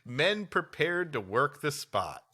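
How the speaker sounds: usual speaking voice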